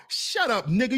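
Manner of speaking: normal talking voice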